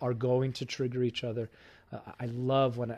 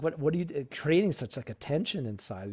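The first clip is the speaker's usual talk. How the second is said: usual speaking voice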